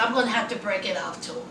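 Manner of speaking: low, soft and quick; subdued confession. Retold or imitated. Rebecca to herself about breaking up, subdued. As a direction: slip it out quietly and fast.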